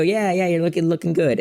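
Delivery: put-on agreeable reply; retold breezy approval. Acting his agreement at barber's.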